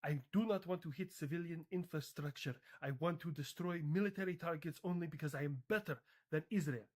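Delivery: deliberate mock Iranian accent. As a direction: state it firmly in a put-on Iranian accent. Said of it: "so hard to say in this accent".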